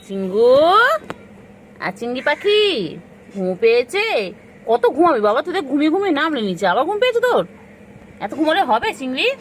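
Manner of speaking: high, loud, sing-song calling to a pet. Real, not acted. Owner calling her sleepy pet Chingri, loud and high.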